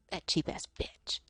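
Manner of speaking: voiceless whispered mock insult. Imitating the streamer's catty aside, whispered. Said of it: "she just goes like".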